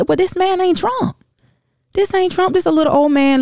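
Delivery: high, incredulous protest; exasperated retort. Retold or imitated. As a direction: throw it back in disbelief. Her exasperated retort to cousin.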